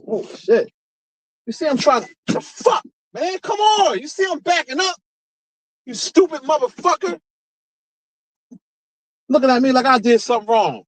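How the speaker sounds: real road rage. Real, not acted. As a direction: blow up at the driver who cut in. Yelling at driver in traffic.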